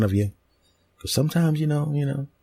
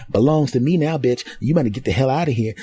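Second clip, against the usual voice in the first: put-on sassy new girlfriend; 6 semitones higher than usual. New girl claims him.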